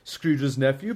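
ordinary delivery, nothing marked

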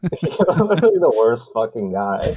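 laughing through the words; words bubbling over with real laughter. Real, not acted. To the co-host about Sonic, said laughing.

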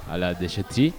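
normal talking voice

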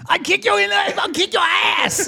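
high, slow shouted doctor voice; belligerent drunk. Acted. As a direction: bellow it out like a crazed doctor. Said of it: "a voice talking over a P A system".

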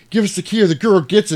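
high, quick acted threat; mock threat. Man grabs the daughter for keys, hammed-up threat. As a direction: threaten in a comic rush.

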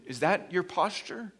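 ordinary delivery

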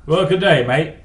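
attempted Australian accent. Shown as a demonstration. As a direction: give a casual Australian greeting. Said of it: "do your best Australian accent".

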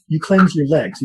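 usual speaking voice

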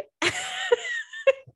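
squealing high laugh; high giggle. Real, not acted. Laughing at the story.

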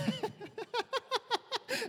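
long, soft, high-pitched laughter; real run of helpless giggles; high giggling laugh. Real, not acted. Laughs at his own teasing.

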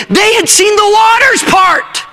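high, slow shouted preaching line; shouted climax. Real, not acted. Preacher to youth, shouting slowly over the crowd. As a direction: cry it out to the congregation, drawn out.

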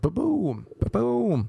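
high mock heartbeat ba-boom; heartbeat noise. Telling of his reaction, a high heartbeat sound. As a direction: mimic a pounding heart, high and light.

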